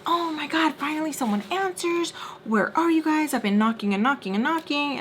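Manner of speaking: high-pitched, exasperated rush of relief and complaint; exasperated caller. Retold or imitated. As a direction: imitate a frantic woman who finally got through. Frantic caller finally gets an answer.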